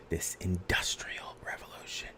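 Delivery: hushed, voiceless whisper; dramatic whisper. Pretend. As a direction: whisper it for effect, very softly. Teacher drops to dramatic whisper.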